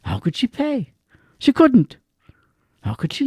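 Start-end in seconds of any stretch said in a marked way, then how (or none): none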